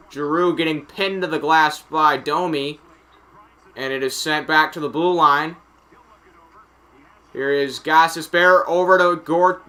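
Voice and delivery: live hockey call, lifting as the puck nears the middle; focused, projected commentary; play-by-play. Acted. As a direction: follow each pass like a sportscaster.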